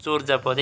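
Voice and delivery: চোরযাপদ as "chor-japod"; correct pronunciation. Shown as a demonstration. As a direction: round the first vowel of চোরযাপদ to "o". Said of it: "তাহলে চর্যাপদের সঠিক উচ্চারণ কী হবে?". (চোরযাপদে "চোর্" ও বর্গীয় জ)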